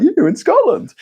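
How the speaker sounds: high, loud joking proclamation; mock announcement. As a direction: shout it out like a gleeful border guard. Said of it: "be like".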